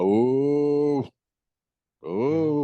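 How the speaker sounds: long drawn-out crowd "oohs"; mock-offended "oohs". Comic mimicking touchy people, mock-offended "oohs". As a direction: imitate an audience disapproving, "ooh". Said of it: "But people are".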